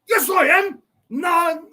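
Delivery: high, loud indignant yell; mock shout. The lady yelling back at Bob, high and loud. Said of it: "And he goes".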